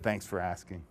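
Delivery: tired dig at his question; weary sarcasm. Acted. Miss A, weary, answers Mister B.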